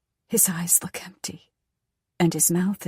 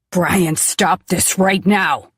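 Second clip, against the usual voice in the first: put-on loud, slow childish yell; 8 dB louder, slower than usual. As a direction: shout it like a tantrum. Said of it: "Dad yells".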